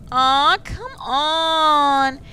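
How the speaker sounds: long, drawn-out loud whine; pleading protest. Acted. Cannibal refused the rump steak, whining plea. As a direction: stretch it high and loud in protest.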